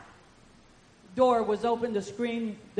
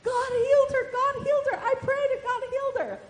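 much higher, bright with joy, imitating the happy woman; ecstatic; 14 semitones higher, 4 dB louder than usual. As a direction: gush the news like a thrilled old lady. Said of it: "and she was ecstatic".

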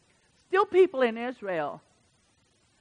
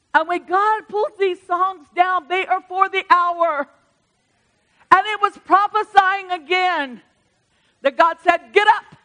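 preaching climax; 7 semitones higher, 7 dB louder than usual. Real, not acted. Preacher rousing the church, high and loud.

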